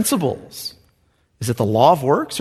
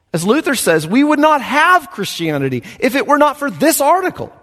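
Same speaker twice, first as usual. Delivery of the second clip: higher, building proclamation; preacher at full force; fervent preaching; 6 semitones higher than usual. Acted. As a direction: preach it out to the crowd. Preaching climax.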